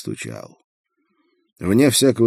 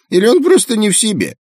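high squawky snickering pelican; giggling pelican; 8 dB louder, faster than usual. Acted. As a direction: snicker it like a mocking bird. Pelican mocks.

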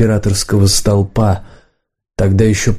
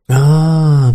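long drawn-out, raised "ah"; drawling; 6 semitones higher than usual. A narrator's reading. Подставка answering Варан while studying a shell, drawling. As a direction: stretch the vowel out at a higher pitch. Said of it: "протянул Подставка".

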